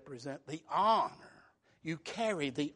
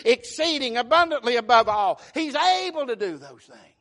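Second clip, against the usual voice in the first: loud, high, driving sermon delivery; full-force preaching; 6 semitones higher, 13 dB louder than usual. Acted. Pastor rousing his congregation on Sunday morning, loud and high. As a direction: preach it out to the back pews.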